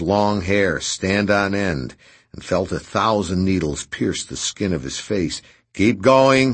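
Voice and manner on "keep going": loud, high, one-note order; monotone shout. Acted. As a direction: repeat it like a machine, loud. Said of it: "he repeated in a monotone".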